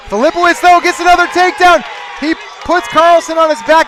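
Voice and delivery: loud, high burst on the takedown; excited play-by-play. Acted. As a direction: erupt as the match blows open. Live play-by-play, big takedown.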